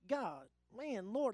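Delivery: ordinary talk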